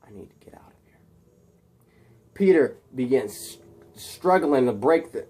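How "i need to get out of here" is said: barely audible whispered aside; alarmed whisper. Acted. Peter realising Harry is crazy, alarmed whisper. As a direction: whisper it urgently to yourself. Captive's aside after villain's demand.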